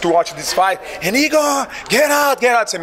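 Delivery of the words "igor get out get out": fans shouting. Retold or imitated. His fans from Switzerland yelling at him to escape, high shout. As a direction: call out over the crowd noise like his cheering fans. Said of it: "and then I saw my, my guys".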